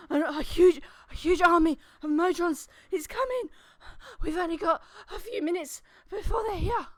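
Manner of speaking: loud, high, breathless warning; panicked young lad. Acted. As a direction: shout the news like a frightened boy. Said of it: "young lad runs up and he's just like".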